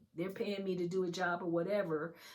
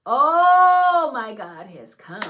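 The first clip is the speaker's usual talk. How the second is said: loud, drawn-out jubilant cry; real excitement; 7 semitones higher, 20 dB louder, slower than usual. Real, not acted. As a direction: burst out slowly, high and very loud. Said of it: "I'm so excited".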